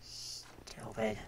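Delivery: soft unvoiced whisper. Real, not acted. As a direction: hiss it under your breath. Muttered after the mistake.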